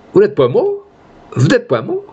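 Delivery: high, rising, incredulous question; stunned disbelief. A narrator's reading. Duguay to the father-in-law he thought dead, stunned and high. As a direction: ask it as if you can't believe your eyes. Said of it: "La surprise, l'indignation".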